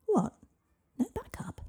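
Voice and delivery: high, squeaky disbelief; startled squeak of protest; dismayed surprise. Acted. Girl protests at going alone.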